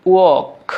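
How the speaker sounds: walk as "wawk", standing for work without r; British pronunciation. Shown as a demonstration. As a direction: say walk with the r silent. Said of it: "British অ্যাকসেন্টে বলবো".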